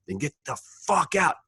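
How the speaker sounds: high-pitched mock yell; retold yelling. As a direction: imitate the coach yelling him out. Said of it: "cursing, yelling".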